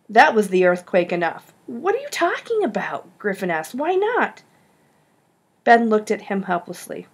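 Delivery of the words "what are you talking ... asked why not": much higher, stunned questions; can't believe what he just heard; shocked disbelief. Acted. Griffin hearing Ben refuse him, high stunned disbelief.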